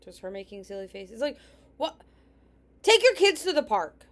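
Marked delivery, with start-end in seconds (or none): 2.8-4.1 exasperated scold. Real, not acted. Scolding mother in video.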